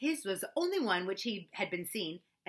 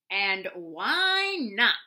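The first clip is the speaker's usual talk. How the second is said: very slow, cold retort; coldly; 8 dB louder, much slower than usual. Acted. Eeyore answers Roo coldly as he spins in the river. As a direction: say it icily, unbothered. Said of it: "said Eeyore coldly".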